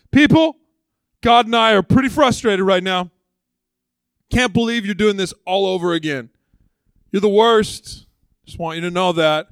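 loud, high scolding rant at the crowd; barking Moses. Retold or imitated. Moses scolding the complaining people, barking loudly. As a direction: yell at the people like a fed-up pastor. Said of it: "barks at everyone".

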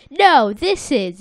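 loud, slow, drawn-out "No"; acted emphatic contradiction. Sandy correcting SpongeBob, acted emphatic denial. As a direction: correct them emphatically in character. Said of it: "Sandy says".

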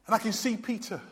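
usual speaking voice

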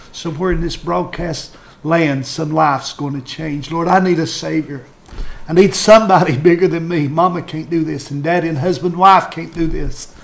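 fervent altar prayer. Real, not acted. Pastor leading a sinner's prayer to Jesus, fervent pleading. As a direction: pray with rising urgency, pleading line after line.

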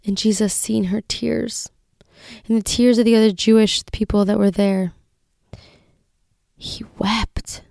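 usual speaking voice

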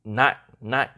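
"nặng" short, abruptly stopped. Shown as a demonstration. Host recapping the last tone, "nặng" clipped short and firm. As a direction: stop "nặng" dead each time. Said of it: "the dot, you know, just".

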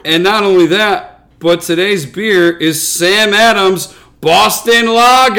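put-on loud, high Boston accent announcement. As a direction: boom it out in a thick Boston accent. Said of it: "we were gonna try our absolute hardest not to do a Boston accent".

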